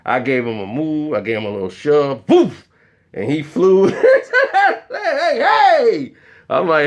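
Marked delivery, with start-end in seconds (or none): 2.3-2.7 high, sudden impact noise; short punched sound effect; whoosh of a shove. Retold or imitated. Imitates the shove. 3.9-6.1 high-pitched yelps of alarm; squealed, startled objection; mock protest. Mimics the shoved actor protesting.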